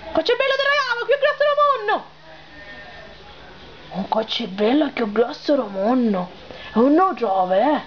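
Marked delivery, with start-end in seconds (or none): 0.1-2.1 put-on high, fast girlish voice with sicilian accent. Girl's high pleading promise. 3.9-6.3 low man's voice. Acted. Low voice repeats the promise.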